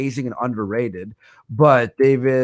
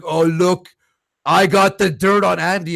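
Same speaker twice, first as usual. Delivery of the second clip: raised mock-gloating quote; mocking imitation; 3 semitones higher, 3 dB louder than usual. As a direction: parody a rival crowing over his scoop. Mimics rival's gloating post.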